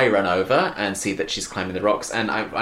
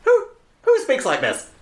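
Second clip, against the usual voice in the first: incredulous; 11 semitones higher than usual. Retold or imitated. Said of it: "And I put".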